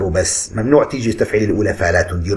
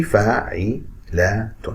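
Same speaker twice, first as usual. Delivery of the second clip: فاعلات said very slowly and softly, beat by beat; drawn-out syllables; 3 semitones lower, 5 dB quieter, much slower than usual. Shown as a demonstration. Teacher slowly sounding out فاعلات for the class. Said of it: "هاي نفس الشيء".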